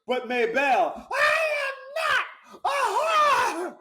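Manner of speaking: high, slow, loud hammed-up shriek; mock scream. Warden pleading with Maybelle, a slow hammed-up shriek. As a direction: wail it like a soap opera victim. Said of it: "And I'd say".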